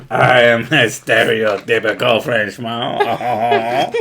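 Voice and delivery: hammed-up French accent, slow and laughing; laughing through a mock French accent; fake French accent. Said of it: "I love the fake French accent".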